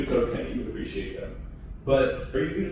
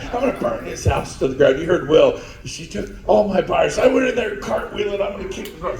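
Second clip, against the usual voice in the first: mock angry rant; 7 semitones higher, 12 dB louder than usual. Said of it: "like Chad".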